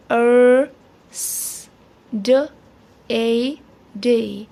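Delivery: अर held long and loud, स hissed, then ड, ए, डे; अर, स, ड, ए, डे with a pause after each; sounding out Thursday. Shown as a demonstration. Teacher breaks Thursday into its sounds, one at a time. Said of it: "U R makes er sound".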